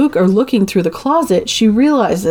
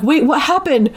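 panicky; 8 semitones higher than usual. Retold or imitated. Panicked teen retold from movie.

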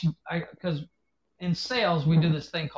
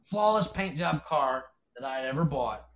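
ordinary talk

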